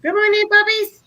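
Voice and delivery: sing-song greeting to the dogs; high puppy talk. Real, not acted.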